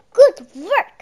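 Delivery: loud, high cheerful praise; praising. A narrator's reading. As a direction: cheer your brother on. Praising Hal.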